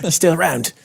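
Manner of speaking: high-pitched mock Australian; Australian accent. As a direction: play up an Aussie accent. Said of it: "gonna hear my Australian accent".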